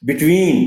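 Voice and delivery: Between with a stretched ween; Between, hold the ween long. Shown as a demonstration. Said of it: "वीन की जो sound है, वो लंबी करके".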